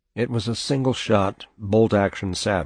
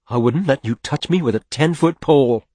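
loud, higher, angry refusal; mad; 6 semitones higher, 6 dB louder than usual. Acted. Mother rebuffing father's mock offer, just as mad. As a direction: reject him furiously, voice raised. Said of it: "sounded just as mad".

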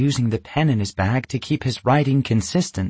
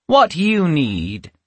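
higher, slow sinister witch; 6 semitones higher, 3 dB louder, much slower than usual. A narrator's reading. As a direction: draw it out, sinister. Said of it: "the witch said".

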